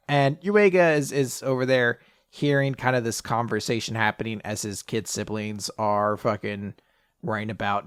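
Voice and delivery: ordinary talk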